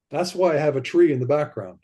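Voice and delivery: normal talking voice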